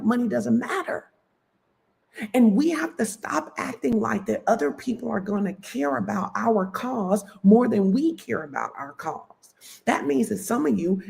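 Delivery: high, impassioned sermon pitch; fired-up preacher urging her audience; preaching. Real, not acted. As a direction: preach it, rising up with conviction. Preaching about money at full force.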